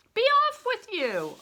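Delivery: high, loud shout; angry guard shouting a boy off; soldier's shout. Acted. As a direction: bark him away with a yell. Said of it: "a soldier shouted".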